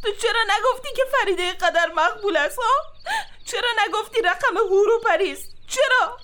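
half laugh, half sob, rising high; laughing through tears. Acted. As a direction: hysterical crying laugh. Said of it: "آرام باش دیوانه".

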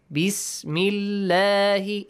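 Bismillahi chanted slowly with a thin, light L; light, flat lam. Shown as a demonstration. Teacher showing the kasra rule, Bismillahi with light L, drawn out. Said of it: "a flat sound".

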